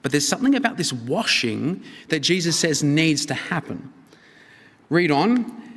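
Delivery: normal talking voice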